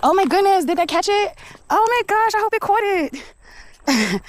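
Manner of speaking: very high, thrilled squeal; excited squeal. Real, not acted. Walker hoping the camera caught it, excited squeal. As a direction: squeal in delight, drawing out the last words.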